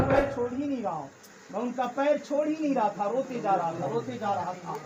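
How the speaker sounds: tearful hush. Retold or imitated. Youth recounts weeping at feet.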